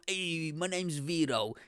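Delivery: put-on slow, drawn-out Italian-American accent; mock Detroit Italian-American guy; Vito. Comic imitating an audience member's introduction, Italian-American accent. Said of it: "This is how he sound".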